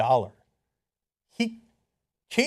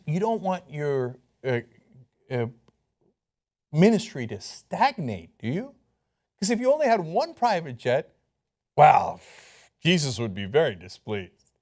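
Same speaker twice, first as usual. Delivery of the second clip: mock preacher; 5 semitones lower than usual. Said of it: "He says".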